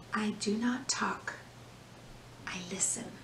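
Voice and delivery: hushed, breathy whisper. Acted. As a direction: whisper it like keeping still. Quiet listening walk.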